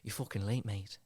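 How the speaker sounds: quiet menacing Nottingham voice; Nottingham accent. Acted. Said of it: "he goes".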